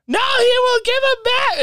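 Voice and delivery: high, loud insistent mom; mom insisting. Retold or imitated. Mom arguing with grandma over presents. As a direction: snap back at grandma.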